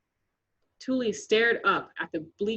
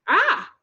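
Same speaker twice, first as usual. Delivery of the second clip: high loud shriek; girl slipping on ice, crying out; cry of pain; 11 semitones higher, 7 dB louder than usual. Acted. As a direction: yelp as you fall. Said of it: "she cried out".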